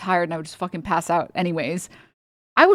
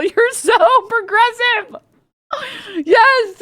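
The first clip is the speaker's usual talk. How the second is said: much higher and slow, drawn-out mock praise; hammed-up sneering praise; exaggerated sarcasm; 14 semitones higher, 4 dB louder, much slower than usual. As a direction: stretch it out in full sarcasm. Mocking a smug tweeter.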